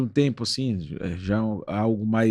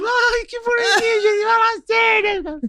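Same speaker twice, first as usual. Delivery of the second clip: very high, drawn-out mock squeal; hammed-up high-pitched gushing; cutesy mimicry of adults; 3 dB louder than usual. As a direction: coo it in a put-on falsetto, stretching the name. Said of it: "você entretinha a galera, você falou".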